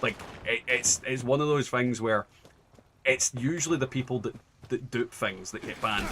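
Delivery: ordinary delivery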